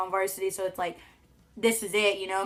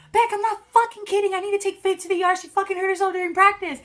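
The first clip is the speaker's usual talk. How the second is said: high, loud, urgent dad; urgent shout; 11 semitones higher, 6 dB louder than usual. Retold or imitated. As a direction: make mom believe it now. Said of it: "he was like".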